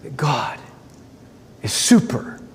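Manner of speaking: whispered in reverent awe; hushed whisper. Real, not acted. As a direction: breathe it out like a secret. Preacher drops to hushed awe.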